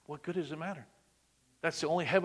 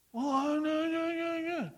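flustered Elijah; 13 semitones higher, slower than usual. Retold or imitated. Elijah stammering his answer.

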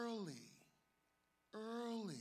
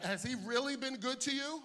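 loud, raised preacher's question; impassioned challenge; 7 semitones higher, 9 dB louder than usual. Real, not acted. Preacher presses congregation about God's goodness, loud and fervent. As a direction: press the question home, higher and louder.

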